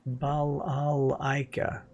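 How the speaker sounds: slow, drawn-out Russian accent. Shown as a demonstration. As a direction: stretch it out like a Russian. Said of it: "try to say that name in a Russian voice".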